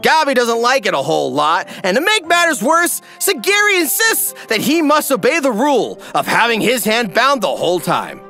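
ordinary talk, nothing marked

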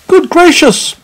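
high, loud exclamation of surprise; astonished. A narrator's reading. Meddle finding cheese in the parcel, astonished. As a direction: burst out in high-pitched shock.

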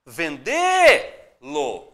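"Vendê-lo" with DÊ loud and very long; stress on DÊ. Shown as a demonstration. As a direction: call out "Vendê-lo", holding DÊ, then a separate LO. Teacher stretches the stressed syllable. ("Vendê-lo" com o DÊ bem alongado)

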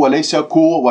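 ordinary delivery

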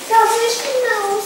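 high, slow mocking question. Mouse scoffing at the fooled snake, high and slow.

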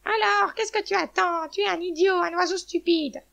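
high, loud hen's outburst; angry shouting. A narrator's reading. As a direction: shout insults in a high hen voice, stretching the last words. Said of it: "Elle regarda l'aigle et cria".

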